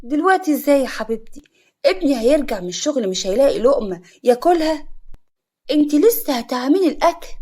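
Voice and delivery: high, incredulous questioning; disapproving. A narrator's reading. Mother-in-law questions bride about late lunch, disapproving. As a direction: throw it back at her, indignant. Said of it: "بصوت فيه لمحة استنكار".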